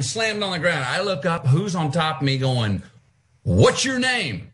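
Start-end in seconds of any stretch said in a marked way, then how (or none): 3.4-4.5 high, drawn-out demand; mother confronting. Retold or imitated. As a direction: stretch it out as a mother's stern challenge. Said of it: "My mother".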